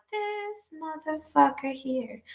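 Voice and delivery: slow, soft, drawn-out disbelief; muttered disbelief. Retold or imitated. Cashier spotting the rude customer, slow soft disbelief. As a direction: drag it out under your breath. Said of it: "I'm like".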